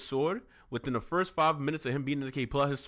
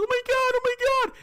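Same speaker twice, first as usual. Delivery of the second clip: very high, fast, loud squealing; hammed-up frantic shriek; mock panic; 8 dB louder, much faster than usual. Mage being stabbed by goblins, acted panic.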